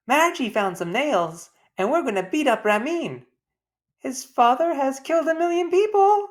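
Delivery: put-on a child boasting to her mother about the plan, excited and loud.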